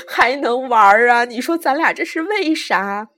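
yelling. A narrator's reading. Last jumper to the narrator before leaping, yelling. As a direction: holler the question in disbelief. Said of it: "他大叫".

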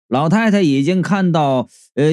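usual speaking voice

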